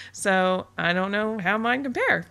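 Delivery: brighter, lilting take, rising before it falls; pleased. Shown as a demonstration. Blogger naming the upside to listeners, pleased lilt. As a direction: say it as good news, with a lilt. Upside version, gleeful repeat of line.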